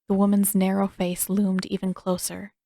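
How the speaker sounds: ordinary delivery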